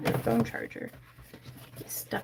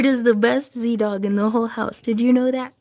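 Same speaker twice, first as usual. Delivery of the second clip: louder, coaxing question; pet talk; 3 semitones higher, 6 dB louder than usual. Real, not acted. Praising her dog, a loud doting question. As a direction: ask the dog warmly.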